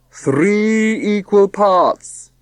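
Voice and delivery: very slow, grand self-important father; solemn pompous voice. Acted. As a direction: proclaim it like a pompous patriarch. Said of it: "solemn, pompous voice".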